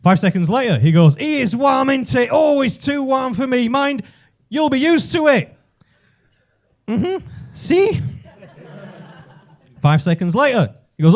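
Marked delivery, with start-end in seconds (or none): 1.2-5.6 higher-pitched talking down to a foreigner; patronising lift companion. Retold or imitated. Man in lift to supposed Spaniard, patronising high small talk. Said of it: "talk to me like that". 6.9-8.0 short hum, then high rising "si"; pretend Spaniard. Playing Spanish for the man in the lift, innocent high agreement. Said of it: "This fucker thinks I'm Spanish".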